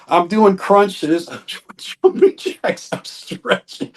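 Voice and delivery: words dissolving into laughter; laughing through it. Retold or imitated. Telling of a secret workout in boxer shorts, laughing through it.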